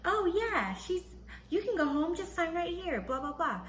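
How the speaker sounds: high, breezy imitation of a nurse; chirpy nurse impression. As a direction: mimic her in a high singsong. Nurse breezily chimes in, retold.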